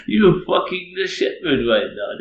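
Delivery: loud, high mock outrage; hammed-up affronted protest; acted indignant outburst. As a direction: belt it out, incredulous. Repeats acted retort after laughing.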